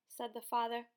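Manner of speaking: plain reading voice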